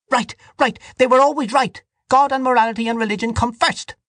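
very high, vehement woman; heated cry. A narrator's reading. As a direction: cry out insistently across the table. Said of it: "cried to Mister Casey".

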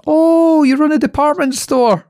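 very high, bright misunderstanding; innocent French lady. Retold or imitated. French lady mistaking Bamford for a department store, very high. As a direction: say it high and sweetly oblivious. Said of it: "And she says".

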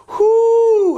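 very high, loud party whoops. Acted. Host breaks into his rant with loud high whoops.

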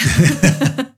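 bright high laugh. Real, not acted. Laugh at the question. (risa aguda)

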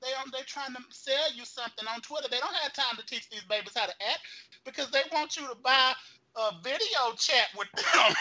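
ordinary talk

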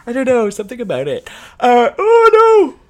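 words stretched by a yawn. Real, not acted. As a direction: talk through a big yawn. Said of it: "Don't you dare yawn".

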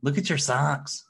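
high, slow mock taunt; imitated arguing woman. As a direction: drawl the insult like a comic voiceover. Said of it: "she said something like".

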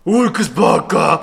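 very slow, dragged Hulk growl. Pretend. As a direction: stretch each word like a dim giant. Host tries Hulk catchphrase. (Hulk lentissimo)

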